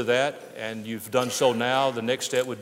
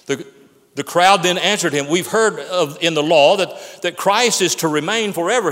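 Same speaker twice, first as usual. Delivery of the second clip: loud, raised preacher's voice reading scripture; forceful preaching; 4 semitones higher, 6 dB louder than usual. Acted. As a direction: proclaim it to the congregation with force.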